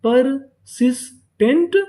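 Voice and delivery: Persistent slow as पर, सिस, tent, last part rising; syllable by syllable. Shown as a demonstration. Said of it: "तो पूरी meaning क्या बनी". (Persistent धीरे-धीरे, हर हिस्सा अलग)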